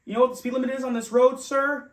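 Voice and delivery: high, loud cop demand; stern cop. Retold or imitated. Officer quizzing the driver on the limit, high and loud. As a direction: quiz him like a strict officer. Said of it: "He goes".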